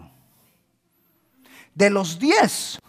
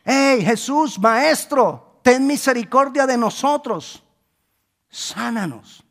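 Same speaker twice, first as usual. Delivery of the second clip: high, raised-voice plea to Jesús; imitando gritos; 6 semitones higher, 4 dB louder, slower than usual. Retold or imitated. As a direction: call out as lepers begging from afar. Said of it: "ellos le empiezan a gritar a Jesús". (gritos de súplica imitados, agudos)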